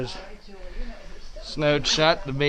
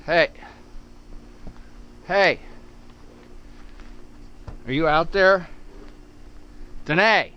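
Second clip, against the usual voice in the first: raised voice calling across the yard; calling from far away; 3 semitones higher than usual. Real, not acted. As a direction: call out to someone outside. Calling to someone outside.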